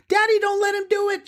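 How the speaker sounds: put-on high, fast shout of a little girl; a girl's pleading cry to her father; child's shout. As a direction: yell for Daddy, quick and urgent. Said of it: "she shouted".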